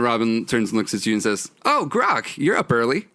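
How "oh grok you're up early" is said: high, lilting character greeting; surprised greeting. Acted. Robin greets early-rising Grok, surprised. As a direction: pitch it up in friendly in-game surprise.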